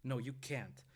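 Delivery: loud, desperate protest; loud refusal. A narrator's reading. Mother defying the knifeman, loud. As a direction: push back with full voice. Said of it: "Catelyn said louder".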